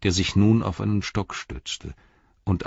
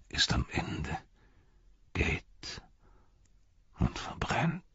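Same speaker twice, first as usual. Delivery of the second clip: hushed, breathy words of doom; despairing whisper; 7 semitones higher, 9 dB quieter than usual. Acted. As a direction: let it sink to a whisper. Denethor's despairing last words.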